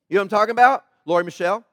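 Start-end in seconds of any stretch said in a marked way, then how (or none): 1.0-1.6 low, firm name-calling; stern middle-name call. Shown as a demonstration. Preacher showing a middle-name scolding, low stern call. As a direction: call out both names as a parent's warning. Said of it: "that's like using your middle name".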